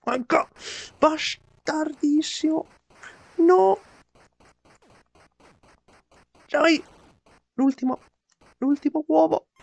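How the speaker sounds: high frantic yelling; explosive italian cursing. Real, not acted. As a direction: yell at the game in despair. Gamer failing last egg.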